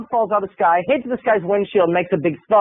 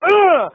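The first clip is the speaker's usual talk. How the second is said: high, sudden "ugh"; imitated elbowing grunt; 8 semitones higher, 3 dB louder than usual. Lady elbowing the man beside her, exaggerated grunt. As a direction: voice a sharp jab of effort. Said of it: "just elbows him out of the blue".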